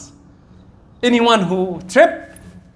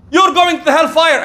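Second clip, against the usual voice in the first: raised, high scolding yell; mock yelling; 7 semitones higher, 5 dB louder than usual. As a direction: imitate a parent yelling a threat. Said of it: "Do it again with yelling?".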